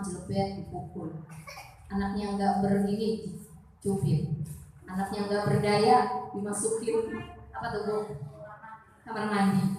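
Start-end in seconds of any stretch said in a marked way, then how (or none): none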